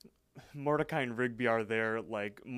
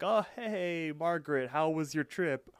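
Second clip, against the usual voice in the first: higher, breezy fake casual greeting; fake nonchalant; 6 semitones higher, 4 dB louder than usual. Mordecai greeting Margaret, playing it cool. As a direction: act like nothing happened. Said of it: "acting all nonchalant and be like".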